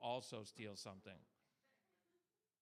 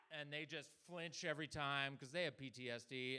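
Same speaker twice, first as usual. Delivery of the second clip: ordinary delivery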